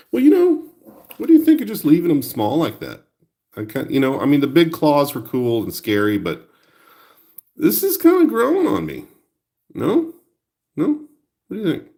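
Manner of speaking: a little creature asking for approval; toy voice. Acted. Toy's voice asks viewers.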